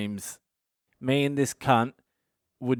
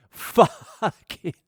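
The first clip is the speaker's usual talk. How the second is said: laughing; 7 semitones higher, 3 dB louder than usual. Real, not acted. Laughing mid-quote.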